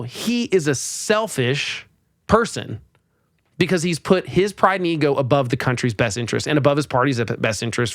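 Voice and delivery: ordinary delivery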